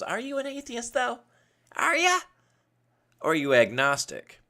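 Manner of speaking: mock neighbor voice. Podcaster mocks his neighbor asking if he's atheist, high squeaky voice. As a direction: exaggerate the neighbor quizzing you, up high. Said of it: "he doesn't talk like this".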